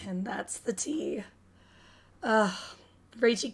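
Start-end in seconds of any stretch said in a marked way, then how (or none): 0.1-1.2 whisper. Real, not acted. Streamer whispers a quip.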